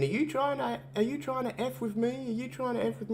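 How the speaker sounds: high, tense mock accusation; suspicious Punk. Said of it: "he's sitting there thinking".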